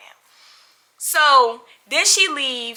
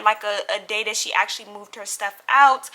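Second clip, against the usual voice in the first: usual speaking voice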